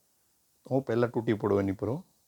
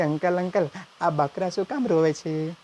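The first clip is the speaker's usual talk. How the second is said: put-on higher, slow, innocent boy; lightly voiced kid; 6 semitones higher than usual. Nephew asking uncle, innocent kid voice. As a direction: ask like a wide-eyed child.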